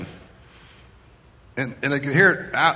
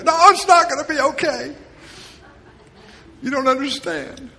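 put-on much higher, louder teen cry of protest; teen outburst; 11 semitones higher, 4 dB louder than usual. Teen shouting down a comforting parent, overwrought. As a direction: cut the parent off in teenage protest. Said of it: "teen cutting off the parent".

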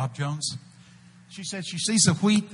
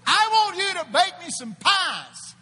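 prophetic shout; 12 semitones higher, slower than usual. Retold or imitated. Preacher telling a stranger to bake pies, shouting. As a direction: proclaim it like a prophecy.

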